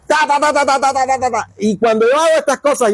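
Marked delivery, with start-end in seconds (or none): 0.1-1.5 higher, rapid mock gunfire "ta ta ta"; spray the imagined religion with bullets; machine gun noise. Angry preacher mowing down religion, rattling mock gunfire.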